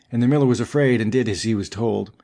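plain reading voice

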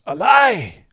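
high, loud, slow hammed-up accusation; mock outrage. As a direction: cry betrayal like a wronged child. Mock outrage over a childhood lie.

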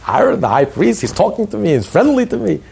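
a lowlife who can't believe the priest noticed him; amazed. Retold or imitated.

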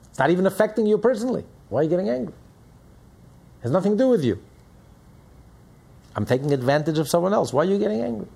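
high, loud indignant protest; indignant sinner. Acted. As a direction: push back like a man caught mid-sin, offended anyone cares. Voicing a sinner rebuffing criticism.

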